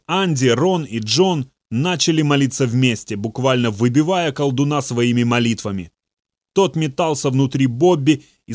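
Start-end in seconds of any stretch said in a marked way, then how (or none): none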